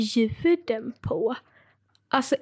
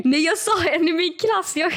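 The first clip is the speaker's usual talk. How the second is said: real laughter breaking into the words; laughing protest; 6 semitones higher, 4 dB louder than usual. Real, not acted. Speaker defends a wrong name, laughing protest. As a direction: protest through laughter, pitched up.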